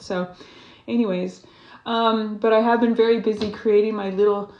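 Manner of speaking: ordinary talk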